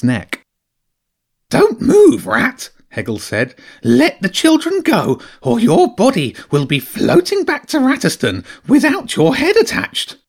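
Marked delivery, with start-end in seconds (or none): 1.5-10.2 fierce hedgehog threat. Acted. Hedgehog with a pike at a rat's neck, high fierce threat.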